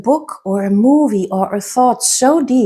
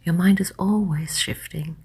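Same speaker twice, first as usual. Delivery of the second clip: soft, lower voice with long pauses between phrases; guided hypnosis; 3 semitones lower, 7 dB quieter than usual. Acted. Hypnotist guiding listeners to let their thoughts drift, soft hypnotic voice. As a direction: lull the listener gently, hushed and unhurried.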